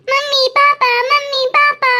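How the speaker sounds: high, urgent calls for mom and dad; girl calling her parents after finding her sister gone. Acted. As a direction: call out to them in alarm.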